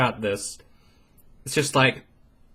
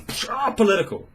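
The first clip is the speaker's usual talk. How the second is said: scoffing; 7 semitones higher than usual. Retold or imitated. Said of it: "And they say".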